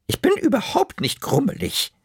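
hohe Omastimme, trotzig grummelig; grummelige Oma. Acted. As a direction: spiel die beleidigte Oma, die es abstreitet. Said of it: "ganz grummelig". (grummelige Oma mit hoher Stimme)

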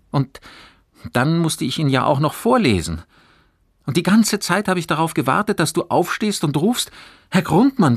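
put-on high, loud boy's voice pouring it out; agitated boy confessing. A narrator's reading. Fabian confesses the stolen essay to his friend, agitated.

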